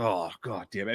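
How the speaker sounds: low, quiet exasperation; imitated disgusted cop under his breath; retold disgust. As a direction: grumble it low, fed up with someone. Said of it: "he's disgusted with me".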